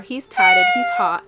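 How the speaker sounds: swooning excitement. Real, not acted. Reader describing the hunky bar owner, squealing loud and high. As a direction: gush about him like a fangirl. Gushing about the hot love interest.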